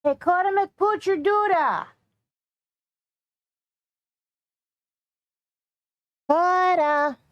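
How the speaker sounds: high, slow sing-song puppy talk; talking to a dog. Real, not acted.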